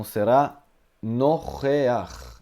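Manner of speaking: nocheach, guttural ch sound; teacher modelling nocheach. Shown as a demonstration. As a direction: say nocheach with a throaty ch at the end. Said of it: "a palavra, tá escrita assim".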